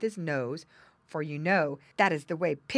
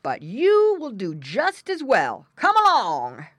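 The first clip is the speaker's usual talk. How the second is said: loud, blustering bear voice; exclaiming bear; 6 semitones higher, 9 dB louder than usual. A narrator's reading. Bear explains himself to captured pig, exclaiming. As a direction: boom at your captive, relishing it. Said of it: "exclaimed the bear".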